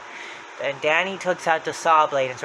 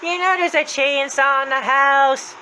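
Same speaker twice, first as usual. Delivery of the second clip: Happy's voice; 11 semitones higher, 5 dB louder, slower than usual. Retold or imitated. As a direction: swoop up and draw it out mockingly. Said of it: "Happy says".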